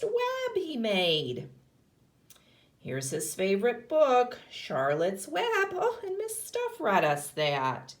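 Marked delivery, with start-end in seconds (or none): none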